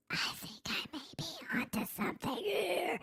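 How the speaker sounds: very soft, high, strained whisper; mock whisper. Streamer repeating their own line for fun, eerie high whisper. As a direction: squeeze it out as a tiny high whisper.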